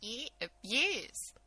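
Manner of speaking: high, squeaky little gremlin voice. Acted. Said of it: "why do you sound like that?".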